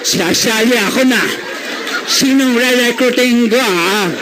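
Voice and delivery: comic put-on elderly voice; hammed-up old-timer, drawn out; ninety-year-old person. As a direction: play someone very old still looking to join. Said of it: "Ano na itsura mo nun?".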